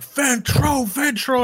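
high crowd chant of a name; cheering crowd. Acted. Game master as fans near the stage, high cheering chant. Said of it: "And there's like".

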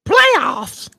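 high, loud, incredulous coach rant; Jim playoffs rant. Retold or imitated. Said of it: "Shout out to Jim".

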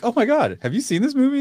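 high, eager question; excited. Retold or imitated. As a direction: gush about the movie. Excited movie suggestion.

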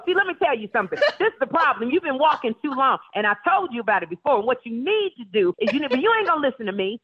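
hammed-up bossy best friend handing out advice; playful impression of a friend lecturing; imitating Niecy Nash. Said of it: "And she'll go".

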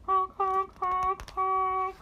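high, loud goofy car horn; silly clown. Acted. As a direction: honk like a toy car horn. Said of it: "I can make noise like a car".

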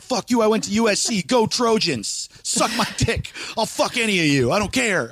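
hammed-up defiant taunting; retold defiant yelling. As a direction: heckle back at a rowdy crowd, held back. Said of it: "they're yelling".

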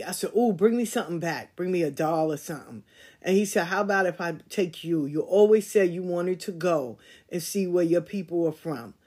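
usual speaking voice

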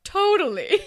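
high, loud, hammed-up agreement; Fitz. Imitating Fitz answering Claire, blithely overacted. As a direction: overact it like a bad actor. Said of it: "he's a terrible actor".

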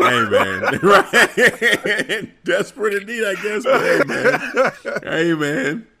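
chuckling shrug; laughing. Real, not acted. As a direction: laugh through it.

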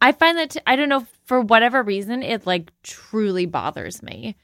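high, exasperated complaint. Real, not acted. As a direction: let the irritation rise high. Exasperated about the character.

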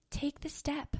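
hushed whisper of encouragement. Acted. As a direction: breathe it out as a gentle push.

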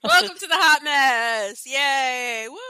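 slow, loud hyped welcome; excited announcer. Acted. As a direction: announce the show with big cheer. Hamming up show welcome.

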